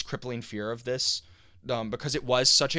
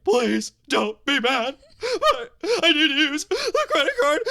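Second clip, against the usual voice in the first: high, loud, sobbing plea; retold crying; 8 dB louder, slower than usual. Son begs his mom on the phone, crying loud and high. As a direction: wail it through tears, much higher. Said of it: "And I burst into tears".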